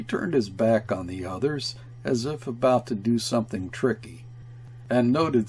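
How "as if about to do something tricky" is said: usual speaking voice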